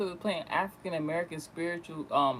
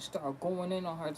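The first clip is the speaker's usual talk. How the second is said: normal talking voice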